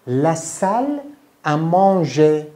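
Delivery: La salle à manger drawn out very slowly and loud, manger stretched; teacher modelling French words for repetition, syllable by syllable; La salle à manger lento e chiaro; slow French model. Shown as a demonstration.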